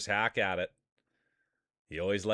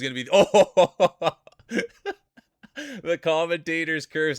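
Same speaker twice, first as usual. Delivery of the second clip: higher and louder through real laughter; laughing; 7 semitones higher, 8 dB louder, slower than usual. Real, not acted. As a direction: crack up mid-call. Crash right after praising player.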